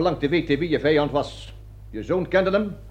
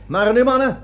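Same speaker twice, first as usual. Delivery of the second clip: rousing call; 6 semitones higher, 5 dB louder than usual. Acted. Colonel rouses his men.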